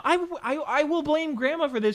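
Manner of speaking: high-pitched indignant protest; indignant outburst. Real, not acted. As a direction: blurt it out in mock outrage. Indignant outburst blaming Grandma.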